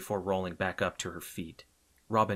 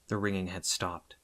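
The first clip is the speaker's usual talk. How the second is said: usual speaking voice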